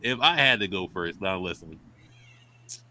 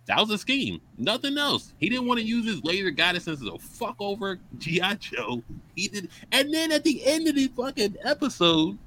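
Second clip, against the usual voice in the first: incredulous rant; 10 semitones higher than usual. Real, not acted. Ranting disbelief about the villain.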